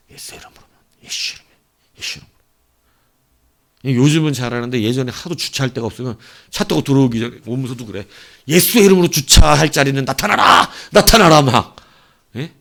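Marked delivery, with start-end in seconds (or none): 0.1-2.3 whispered repeating under the breath; muttered prayer. Retold or imitated. Young preacher murmuring Jesus' name to himself, whispered. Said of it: "속으로 막 읊조었던 게 있어요". 8.5-11.4 mock command. Commanding a parking spot.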